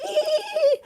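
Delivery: very high-pitched "ee" like a jackhammer; imitated jackhammer squeal. Fan imitating the sketch's jackhammer guy, high squeal. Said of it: "he's like a jackhammer going".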